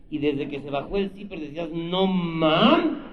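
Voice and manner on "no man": loud, high stunned gasp; hammed-up amazement; mock awe. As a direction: gape in disbelief. Reacting to huge member.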